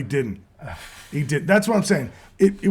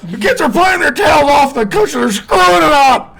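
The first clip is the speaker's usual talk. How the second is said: high, loud imitation of the ranting coach; angry coach rant; 12 semitones higher, 9 dB louder than usual. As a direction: yell it like an outraged college coach.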